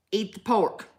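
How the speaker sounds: voice of God. Acted. The Lord commanding a pork-hater, lightly voiced God. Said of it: "You know how God speaks".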